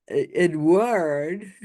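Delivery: slow French-style "Edward"; put-on French accent. Shown as a demonstration. YouTuber mimics psychic's name, mock French accent. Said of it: "some sort of accent".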